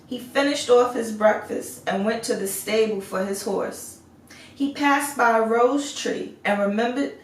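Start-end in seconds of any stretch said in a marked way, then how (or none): none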